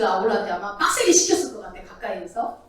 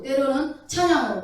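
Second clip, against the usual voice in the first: ordinary talk, nothing marked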